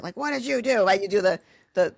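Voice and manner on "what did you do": mock scolding of a dog; yelling at dog. Shown as a demonstration. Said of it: "like, yell at a dog or something".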